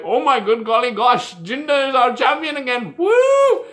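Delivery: hammed-up glee rising to a high, loud whoop; mock excited fans. Ranter mimicking fans thrilled about their champion, mock excitement. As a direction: gush like an overjoyed fan, mockingly.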